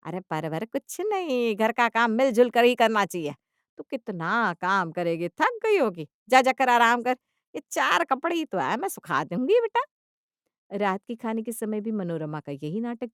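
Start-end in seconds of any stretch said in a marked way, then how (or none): 0.1-9.8 loud, over-sweet insisting mother-in-law; put-on doting concern; fussing mother-in-law. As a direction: insist with exaggerated care, swooping up in pitch.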